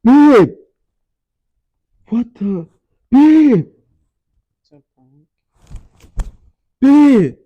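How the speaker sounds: loud high pleading shouts of babe; shouting babe. Real, not acted. Boyfriend calling his girlfriend after the prank, loud and desperate. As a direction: yell for her, desperate and loud.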